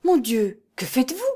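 startled question climbing high at the end; alarmed cry. A narrator's reading. Trembling old woman questions the monk, alarmed cry. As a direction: ask it in rising alarm. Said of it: "s'écria-t-elle".

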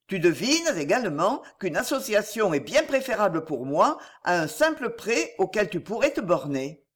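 ordinary delivery, nothing marked

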